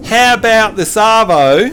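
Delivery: slow, broad Aussie question; Australian accent demo. Shown as a demonstration. Host models a phrase for the learner, Australian accent. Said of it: "show you how to talk Australian".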